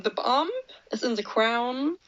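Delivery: ordinary delivery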